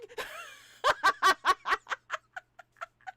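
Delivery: loud rising burst of laughter; real laughter at her own story; big laugh. Real, not acted. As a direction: laugh out loud, climbing high.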